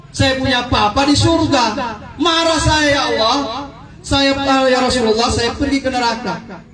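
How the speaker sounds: the daughter crying out her shock; agitated daughter. Acted.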